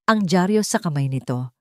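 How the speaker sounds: usual speaking voice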